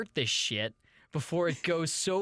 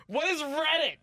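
Missus Weiss; 11 semitones higher than usual. Pretend. Host plays a guest's mother asking for her son, high and fretful. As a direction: fret like an anxious mom looking for her son. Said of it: "Ryan's mom".

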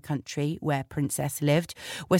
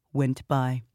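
plain reading voice

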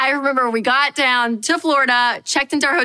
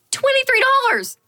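"Twenty-three dollars" much higher, hit hard on the first word; outraged disbelief. Shown as a demonstration. Repeating the menu price to the audience in outraged disbelief.

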